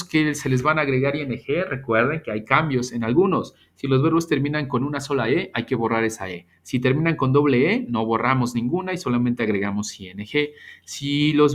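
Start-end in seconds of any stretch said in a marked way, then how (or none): none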